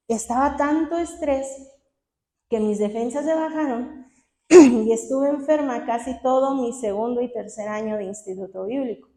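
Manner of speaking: ordinary talk, nothing marked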